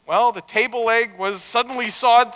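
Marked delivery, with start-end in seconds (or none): none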